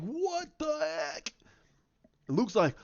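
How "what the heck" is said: high, squeaky "What the heck?"; stunned disbelief. Retold or imitated. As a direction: gape at the reveal. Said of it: "you're like".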